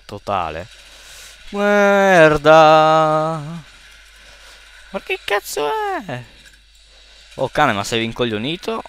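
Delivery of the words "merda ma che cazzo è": strillo acutissimo incredulo; grido sorpreso. Real, not acted. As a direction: urla di sorpresa e rabbia. Spavento e rabbia in gioco. (strillo incredulo)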